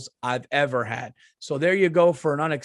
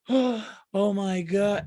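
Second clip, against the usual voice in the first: drawn-out mock outrage; 6 semitones higher, much slower than usual. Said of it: "people going".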